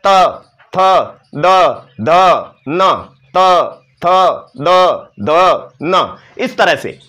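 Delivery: त थ द ध न spoken one by one, tongue against the front teeth; slow demo of त, थ, द, ध, न; dental series demo. Shown as a demonstration. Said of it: "वो आगे वाले दांतों के बीच में जा".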